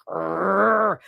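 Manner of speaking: drawn-out throaty growl; rolling "grrr" growl; imitated growl. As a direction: growl like a cornered animal. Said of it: "I was in full growl mode".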